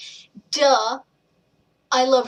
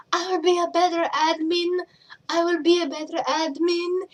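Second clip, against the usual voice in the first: higher mock-earnest voice; mock earnest promise; 6 semitones higher than usual. Mocking her past vows as admin, higher put-on voice. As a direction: parody her own eager vow. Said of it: "I was all".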